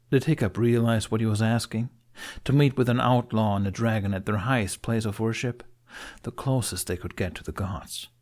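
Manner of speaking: ordinary delivery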